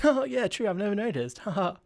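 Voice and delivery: high-pitched, giggly mock agreement; imitated polite laughing reply. New acquaintance replying to small talk at a stoplight, high mock laugh.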